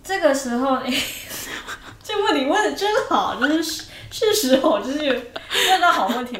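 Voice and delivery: high, loud laughing praise. Real, not acted. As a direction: burst out laughing.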